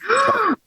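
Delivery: sharp, high gasp of mock shock; a quick theatrical intake of breath; delighted gasp. Real, not acted. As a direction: gasp as if chocolate were scandalous. Host hears guest loves chocolate.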